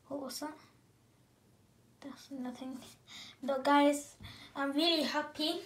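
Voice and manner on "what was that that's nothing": breathy whisper. Real, not acted. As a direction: whisper it to yourself. Whispered aside after noise.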